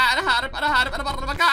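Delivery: 2 high, loud shout mixed with laughter; 3 crow it out as you run away laughing; 4 a player escaping the shop, laughing and shouting; 1 laughing panicked shout. Real, not acted.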